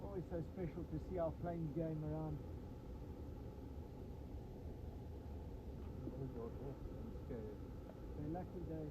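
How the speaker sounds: muffled, far from the mic; muffled voice away from the mic. Real, not acted. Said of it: "apologies if the sound is quite muffled".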